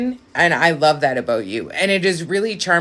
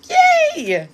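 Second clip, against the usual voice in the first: high, bright "yay" cheer; excited squeal; 3 dB louder than usual. Real, not acted. Reader cheers the viewer's turn, squealing high. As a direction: squeal with delight.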